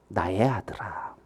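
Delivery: low, soft, soothing address; hushed fatherly reassurance; tender father. Acted. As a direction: call gently to an upset son. Father answers his angry son.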